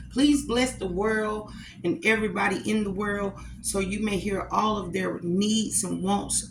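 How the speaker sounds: soft, low mealtime prayer; grace before meal. Acted. Vlogger saying grace over Sunday dinner, soft prayer. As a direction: pray quietly over the plate. Prays over her food.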